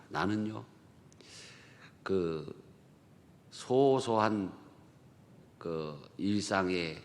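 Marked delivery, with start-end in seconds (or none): 3.5-7.0 slow and savoring. Real, not acted. Savoring small daily happiness.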